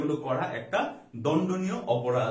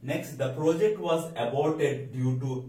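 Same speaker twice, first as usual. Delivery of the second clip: slow dictation; 2 semitones lower, much slower than usual. Shown as a demonstration. Teacher reads example sentence slowly.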